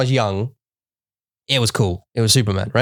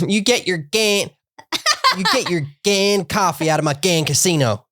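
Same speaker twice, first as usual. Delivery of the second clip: put-on Missouri accent; 8 semitones higher than usual. Imitating Ruth swearing for a friend, in a laughing Missouri accent. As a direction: ham up the Ozark woman's swearing. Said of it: "I'm saying it in that accent".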